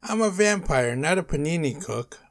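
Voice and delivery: high, loud protest; exasperated shout. A narrator's reading. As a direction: shout it, fed up with the kids. Vampire arguing with the kids.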